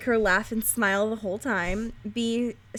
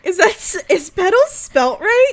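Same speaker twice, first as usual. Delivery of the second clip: high, louder, slowed doubt; surprised question; 8 semitones higher, 6 dB louder than usual. Real, not acted. Friend spotting a misspelling in the quiz, surprised. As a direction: squint at the word in disbelief. Noticing a spelling mistake.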